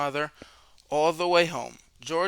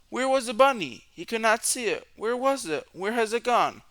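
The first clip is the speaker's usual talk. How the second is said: higher, anxious searching voice; worried searching; 7 semitones higher than usual. A narrator's reading. Narrator voices George hunting the lost bunny, higher and anxious. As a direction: read it as a frantic search.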